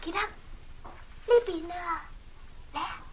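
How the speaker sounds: soft, drawn-out call; slow hushed beckon. Acted. Princess secretly calling Jack to her, hushed. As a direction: coax him over quietly.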